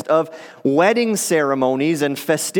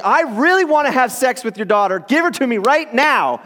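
lightly voiced eager son-in-law, higher; crude request; 5 semitones higher than usual. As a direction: blurt it out like an impatient suitor, a touch higher. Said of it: "going to their father-in-law and saying".

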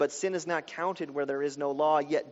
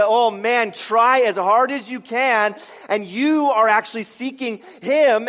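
louder, higher, impassioned preaching; rising into a fervent sermon peak; preaching at full force; 7 semitones higher, 10 dB louder than usual. Acted. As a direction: drive the point home to the congregation.

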